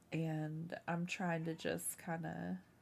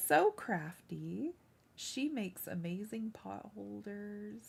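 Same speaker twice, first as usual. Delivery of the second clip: ordinary delivery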